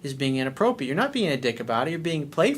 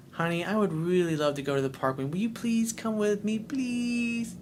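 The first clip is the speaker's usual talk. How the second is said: high sugary begging voice; mock her, rising up sweet and pleading; a boyfriend mimics his girlfriend asking sweetly to go to the park; sweet eyelash-batting plea; 7 semitones higher, 4 dB quieter than usual. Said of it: "like she's batting her eyelashes at you".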